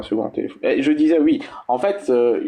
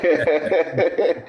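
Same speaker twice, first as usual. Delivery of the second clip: mocking laugh; 5 semitones higher than usual. Real, not acted. Mocking a rival on open mic.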